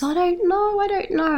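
a hint of a tearful lady at a loss; bewildered lady. Crying lady outside the shop, mildly voiced, lost.

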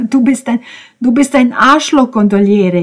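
high, loud whining insult; pained, whimpering complaint; feeble wailing. A narrator's reading. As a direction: wail the insult like someone hurt. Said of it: "jammerte er kraftlos".

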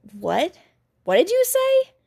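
shocked, shrill high question; panicked; alarmed. A narrator's reading. Shocked man after woman falls.

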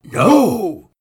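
sudden loud high shout; both men yell to protect the bag. A narrator's reading. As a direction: snap a loud panicked no. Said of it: "both men yelled in unison".

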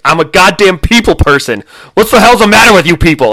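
loud, frantic shouting rant; Tom Smykowski rant. Retold or imitated. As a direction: yell it like a cornered office worker losing it. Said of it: "Thomas Minkowski's like".

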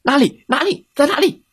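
high, urgent questioning; frantic wolf. Acted. Wolf hurriedly looking round for piglets, high and frantic. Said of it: "大嘴狼伊格尔一边问".

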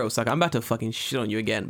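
ordinary delivery

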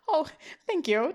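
imitating a lightly frightened voice; mock scared thanks. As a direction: accept a compliment while still a touch scared. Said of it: "Just, like, still scared".

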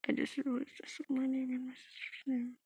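real whispered aside. Real, not acted. Telling viewers the sister screamed names, whispered. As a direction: drop to a breathy hush for the aside.

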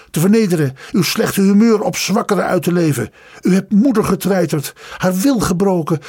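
heated, high-pitched tirade; highly agitated. A narrator's reading. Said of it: "hevig opgewonden". (hevig opgewonden)

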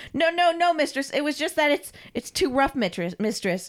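high-pitched, stammering whine; panicky submissive servant. Acted. Carl explains himself to his mistress, panicky. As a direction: squirm and plead with your mistress.

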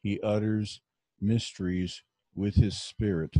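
usual speaking voice

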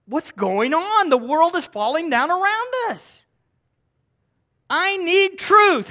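high, loud anxious cry for truth; a listener's mock-desperate inner cry; voicing a desperate seeker. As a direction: plead like someone lost in a collapsing world. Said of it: "you wonder".

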